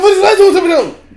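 high, loud mock Japanese outburst; exaggerated, aggressive fake Japanese. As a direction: bark it fast like hammed-up angry Japanese. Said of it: "if they say it the wrong way".

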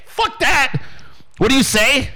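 usual speaking voice

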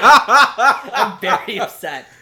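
drawn-out fake outrage, laughing; slow, loud mock complaint with a laugh; mock upset through laughter. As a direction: pretend to be hurt while cracking up. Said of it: "I'm just mad at you right now".

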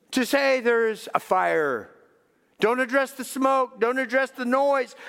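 loud, high, building preacher's cry; preaching at full force. Acted. Preacher to congregation, loud rising exhortation. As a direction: drive the point home to the congregation.

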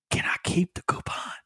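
hushed, whispered and unbothered; calmest voice. Retold or imitated. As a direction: ask it in a calm, airless whisper, as if nothing is wrong. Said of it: "in the calmest voice".

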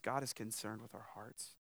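usual speaking voice

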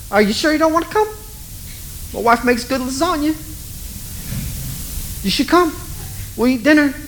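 high, pleading, hesitant; doubtful father. Acted. Father nervously urging Jesus to come to dinner. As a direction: coax him to come anyway, a little comic.

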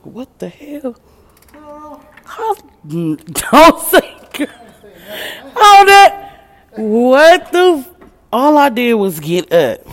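high, loud, laughing in disbelief; shocked laughing outburst. Real, not acted. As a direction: shriek and laugh as the chair gives way. Chair breaks at work.

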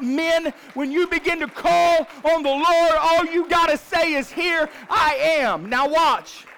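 fiery revival preaching; preaching at full force. Acted. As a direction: whip up the congregation with each line.

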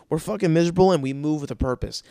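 ordinary talk, nothing marked

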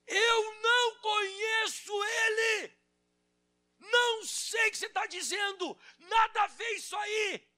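high, slow, desperate shout of denial; Peter's panicked denial. Retold or imitated. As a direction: yell it like a cornered man denying everything. Peter denying Jesus, acted.

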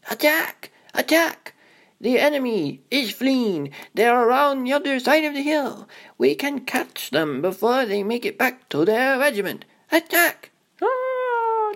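put-on high, excited boyish war cries; rally the toy troops; battle commander play. Boy playing toy soldiers, shouting excited orders.

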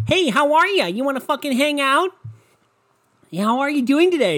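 high, loud, over-friendly put-on girl voice; uppity chick. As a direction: squeal a pushy, overeager greeting. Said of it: "some fucking uppity chick".

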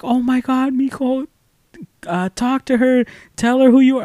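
high, held-up mother's voice; imitated excited mom. Mom on the phone urging him to talk to teacher, high-pitched. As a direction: mimic a mom urging her child on. Mom reacting to his news.

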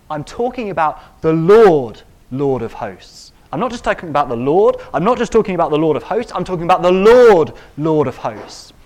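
Isaiah insisting on the Lord of hosts, loud; emphatic Isaiah. Retold or imitated. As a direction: hammer home which Lord you mean. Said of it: "He's saying".

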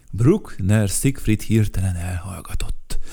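plain reading voice

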